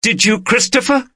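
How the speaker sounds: high, urgent shout of his name; wife shouting at her husband. A narrator's reading. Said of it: "She was shouting now".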